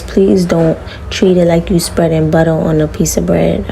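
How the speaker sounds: ordinary talk, nothing marked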